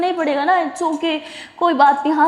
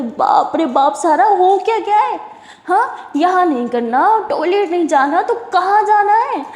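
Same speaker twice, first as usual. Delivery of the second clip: exasperated; 6 semitones higher, 5 dB louder than usual. Acted. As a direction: throw up your hands at her. Jaz exasperated with Sara.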